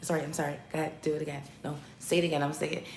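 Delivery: quiet mock apology over the mic; hushed, fumbling back and forth; a hint of a flustered rapper, softly; mimicking forty, hushed. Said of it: "So forty's like".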